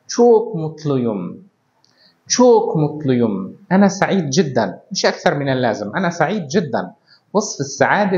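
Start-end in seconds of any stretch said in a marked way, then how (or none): 0.1-3.6 "Çok mutluyum" said very slowly, each syllable clear; slow example sentence. Shown as a demonstration. Teacher repeating a Turkish example for students, slowly. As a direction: model the phrase slowly for learners. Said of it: "نقول مثلًا".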